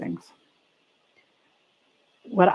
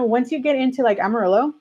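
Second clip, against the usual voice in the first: usual speaking voice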